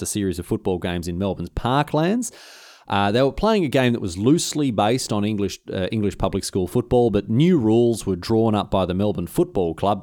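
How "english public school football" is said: normal talking voice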